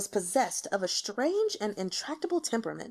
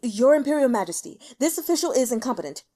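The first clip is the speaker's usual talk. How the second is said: terrified plea; 3 semitones higher, 7 dB louder, faster than usual. Acted. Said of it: "fell to his knees in terror".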